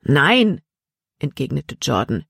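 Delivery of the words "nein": higher, mildly defiant no; defiant refusal. Acted. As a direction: refuse him firmly. (entschiedenes Nein)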